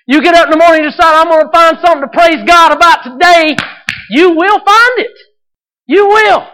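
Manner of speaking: building to high, full-force shouting; impassioned preaching. Real, not acted. Preacher urging congregation to look for thankfulness, building to a shout.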